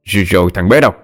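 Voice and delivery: fast, irritated husband scolding; gắt lên. A narrator's reading. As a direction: fire impatient questions at the wife. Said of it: "đẩy vợ ra rồi gắt lên". (gắt gỏng, bực mình hỏi dồn)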